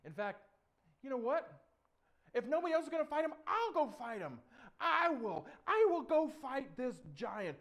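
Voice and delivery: put-on high, loud, determined boy; bold volunteering. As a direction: declare it with rising resolve. David boldly volunteers to fight.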